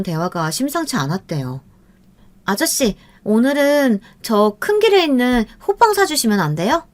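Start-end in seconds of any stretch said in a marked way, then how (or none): 2.4-6.9 put-on high, coaxing young girl's voice; sweet girl's plea. A narrator's reading. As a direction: wheedle like a spoiled girl. Girl asks father for buns.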